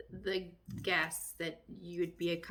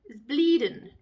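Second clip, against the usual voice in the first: alarmed exclamation; 3 semitones higher, 11 dB louder, slower than usual. Real, not acted. Reacts to injury.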